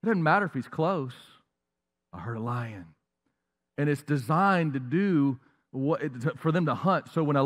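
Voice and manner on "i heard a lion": much lower, much softer, hushed fear; frightened prey. Retold or imitated. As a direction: murmur it like a frozen animal. Prey hearing lion.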